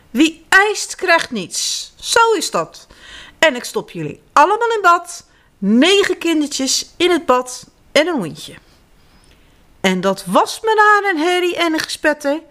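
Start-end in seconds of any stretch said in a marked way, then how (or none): 0.1-8.5 stern mother. A narrator's reading. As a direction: lay down the rule like a strict mom. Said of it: "riep moeder".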